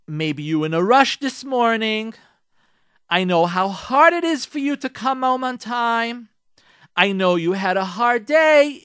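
gently understanding, to husband; wife gently opening a talk with husband; soft gentle wording. Shown as a demonstration. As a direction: coax him softly, kindly. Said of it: "if you cannot say to your husband".

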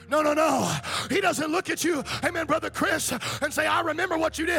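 high, loud whooping preacher; preaching at full force. Acted. Pastor telling the church how God sees them, full whooping preaching. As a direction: ride the music and shout it home. Sermon climax.

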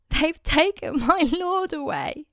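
Mary crying to the angels; grieving Mary. Acted. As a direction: voice Mary, distraught at the tomb. Mary weeping at the empty tomb.

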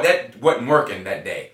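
ordinary delivery

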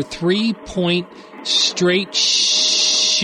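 drawn-out slow readback; slow reading back. Acted. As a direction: read it out slowly, word by word. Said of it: "Read that back to me".

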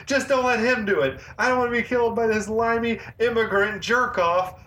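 high-pitched ranting movie-hero imitation; epic rant. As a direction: keep the mock rant pitched high and insistent. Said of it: "Cash goes on that epic rant".

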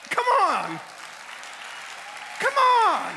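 builds from a firm call to a high loud shout; preacher's swelling come on; rising shout. Real, not acted.

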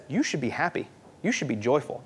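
quietly voiced, low-key urging; soft advice. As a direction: gently tell them what they should be. Voicing somebody's advice to be happy.

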